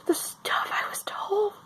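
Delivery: hushed, holding back tears; tearful whisper. Real, not acted. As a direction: whisper it, close to crying.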